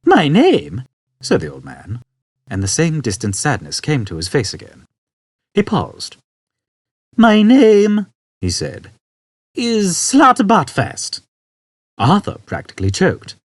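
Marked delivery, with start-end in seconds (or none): 0.1-0.8 put-on high, louder questioning old man. Old man to Arthur, high and sad. 7.2-11.2 high, very slow name; old man sad. Acted. Old man telling Arthur his name, high and slow.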